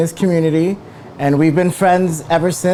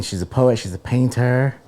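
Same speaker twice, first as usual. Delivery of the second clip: ordinary delivery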